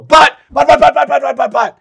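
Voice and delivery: very loud, high-pitched rapid repeated outburst; mock frantic nagging. The mind butting in with excuses, mock frantic yelling. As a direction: fire it out like an alarm that won't stop. Imitating the mind's nagging objection.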